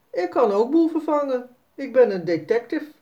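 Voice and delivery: put-on a small boy showing off; hint of a proud young boy; boasting boy. Narrator voices boasting Jasper.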